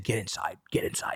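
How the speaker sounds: whispered coaching. Retold or imitated. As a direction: coach him low and secret. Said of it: "And he's like".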